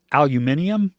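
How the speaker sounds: proper British aluminium. Shown as a demonstration. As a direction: stress MIN in aluminium. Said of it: "proper spelling and pronunciation".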